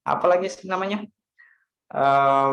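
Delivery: ordinary talk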